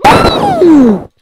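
very high, loud ghostly boo; jump-scare them with a squeal; spooky boo. Pretend. Spooking the enemy.

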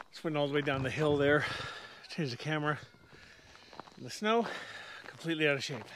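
puffing after the climb; out of breath. Real, not acted. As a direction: talk while still winded. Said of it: "I'm a little, little out of breath".

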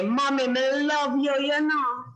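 a touch of Jamaican lilt on "me love you"; Jamaican patois. Shown as a demonstration. Host teaches a boy to tell mommy he loves her in patois. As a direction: say it the Jamaican way, lightly. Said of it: "So you would say".

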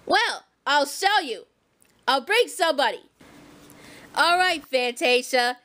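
deep motherly warning; Harriet voice. Pretend. Harriet voice again.

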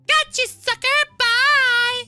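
high, loud getaway taunt; gleeful taunt. Acted. Thief taunts her victim while fleeing, high and gleeful. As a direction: crow it high at the fooled victim.

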